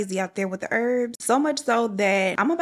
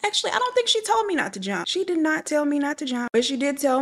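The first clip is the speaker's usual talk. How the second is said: high, fast indignant double-take; sudden realisation; 7 semitones higher than usual. Real, not acted. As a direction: catch herself, outraged and amused.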